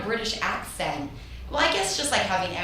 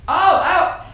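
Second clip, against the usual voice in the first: loud and high, gearing up; giddy, working up the nerve; excited; 7 semitones higher, 10 dB louder than usual. Real, not acted. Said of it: "give me your best British accent".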